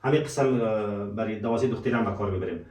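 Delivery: ordinary talk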